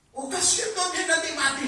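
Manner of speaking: high-pitched pleading to God; acted, high-voiced objection out of pity; pitying plea. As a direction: plead on behalf of someone, voice lifted high.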